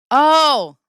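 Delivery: loud high Oh, then dropping on Camille; exclaim, then say her name. Real, not acted. Exclaiming at a chatter.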